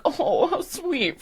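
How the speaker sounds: soft, high, drawn-out coo; cooing audience sigh. Acted. Audience reacting to the contestant's story, sweetly sighing. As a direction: sigh it slowly, touched. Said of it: "a, a sigh".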